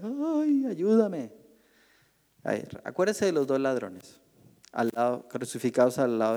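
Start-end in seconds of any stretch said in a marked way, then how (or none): 0.0-1.3 high wailing ay, ayúdame; mock crying plea. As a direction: wail for help like a weeping sinner. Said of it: "empieza a llorar".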